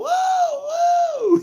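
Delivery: very high, loud whoa; imitating startled walkers; startled pedestrians. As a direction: yelp it in alarm. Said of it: "And they're all".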